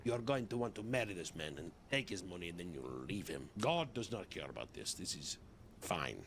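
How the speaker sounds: low, coaxing menace; sinister psychic voice. Acted. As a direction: talk her into it, low and dark. Psychic coaching the woman.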